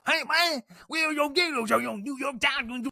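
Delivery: very high, raving street-shouter impression; ranting stranger. Homeless guy accosting a tourist about his Giants shirt, mock ranting. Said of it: "ranting and raving about the New York Giants".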